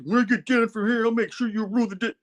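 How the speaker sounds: mock-menacing grumble; villain threat. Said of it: "then he goes back to".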